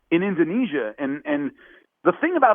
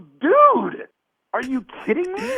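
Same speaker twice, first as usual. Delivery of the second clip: much higher, disbelieving protest; incredulous disbelief; 13 semitones higher, much slower than usual. Retold or imitated. Fighter to the producers, high and incredulous. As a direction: sound like you can't believe them.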